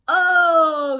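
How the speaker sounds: loud high hammed-up groan of dismay; a crowd's theatrical moan of disappointment; mock moan. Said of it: "the crowd moaned".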